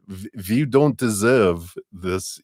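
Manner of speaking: slow, hammed-up German accent; bad German accent. Mind speaking to the heart, in a slow fake German accent. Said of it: "just imagine this is German".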